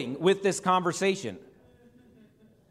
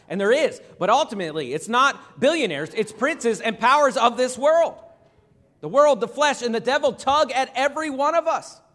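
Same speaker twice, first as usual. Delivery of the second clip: loud, raised, emphatic sermon delivery; forceful preaching; 5 semitones higher, 5 dB louder than usual. Real, not acted. Preacher warning congregation of worldly powers, forceful preaching. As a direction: drive the point home to the congregation.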